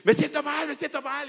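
high, loud cry of distress; panicked shout. Retold or imitated. Trapped man crying out to his boss, panicked. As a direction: yell it like you're suffocating. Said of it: "empecé a gritar. Entonces yo le dije".

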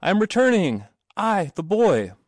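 high, loud announcing call; shouted. A narrator's reading. Boy calling to those at the gate, shouted. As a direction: shout it out proudly into the distance. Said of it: "he shouted".

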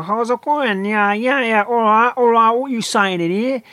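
high, skinny Cockney accent. Shown as a demonstration. East Ender greeting someone in a high, skinny Cockney accent. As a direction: chatter like an East End Cockney. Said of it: "in common with the Cockney East End".

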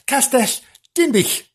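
"Castell Dinbych" in Welsh, rising high on "Dinbych"; "Castell Dinbych" sung out high in Welsh; Welsh place name. Shown as a demonstration. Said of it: "Denbigh Castle".

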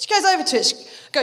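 ordinary talk

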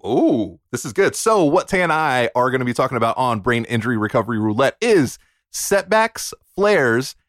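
normal talking voice

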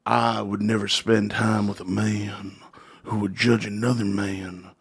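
low, slow, solemn wrestler imitation; Jake the Snake. Wrestler asked about Hulk Hogan, slow solemn imitated voice. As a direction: pronounce it slowly and low, mock grave. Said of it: "And he goes".

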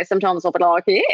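mock Australian accent, tossed off. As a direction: slip into a put-on Aussie accent. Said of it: "when I was in Australia".

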